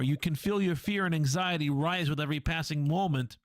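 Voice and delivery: normal talking voice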